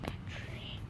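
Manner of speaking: soft whisper. Real, not acted. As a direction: barely breathe the words. Sneaking toward a bird.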